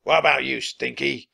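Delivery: booming villain question; pirate captain's roar. A narrator's reading. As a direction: roar at a crewman. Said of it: "Captain Bones roared".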